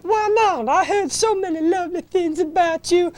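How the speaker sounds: coy Southern Belle falsetto; high, put-on Southern Belle voice; Southern Belle accent. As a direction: demur like a flattered Southern Belle. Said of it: "get a Southern Belle accent".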